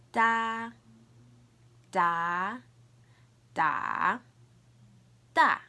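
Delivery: loud "Da" four ways: high level, rising, dipping, falling; sing-out "Da" through the four Mandarin tones, drawn out, last one short; four tones demo. Shown as a demonstration. Teacher modelling for learners to repeat, four loud tones on "Da". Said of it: "we're gonna do the four tones".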